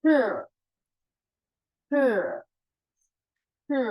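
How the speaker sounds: he breathed from the throat; He: rough throaty h; Mandarin he. Shown as a demonstration. Teacher showing the throaty h. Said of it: "seperti ini pelafalannya".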